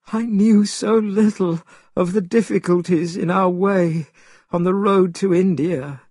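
crying quietly. A narrator's reading. Tripitaka despairing at the river, crying quietly. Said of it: "he cried quietly".

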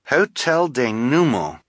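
slow, measured echo of Hotel Denouement; thoughtful repeat. A narrator's reading. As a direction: repeat Hotel Denouement slowly, without crying out. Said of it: "Quigley said".

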